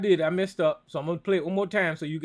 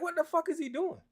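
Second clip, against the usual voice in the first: incredulous outburst; 10 semitones higher, 6 dB quieter than usual. Retold or imitated. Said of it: "I'm like".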